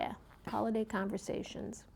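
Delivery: breathy whispered aside. Pretend. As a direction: drop to a whisper for the title. Repeats the event title whispered.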